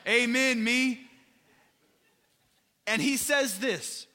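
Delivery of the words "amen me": a louder, higher, fired-up "Amen, me". Retold or imitated. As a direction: belt out "Amen, me" like you just can't hold it in. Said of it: "Jesus gets fired up and he's like".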